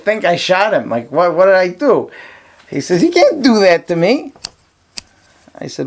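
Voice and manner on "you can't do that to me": high, whiny student protest; pleading protest. Retold or imitated. Student protesting to his rabbi, high whiny complaint. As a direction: plead like you've been wronged.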